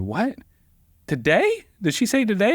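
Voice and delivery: can't believe it's today; panicked "today?"; shocked disbelief. Retold or imitated. Shocked at sudden trial call.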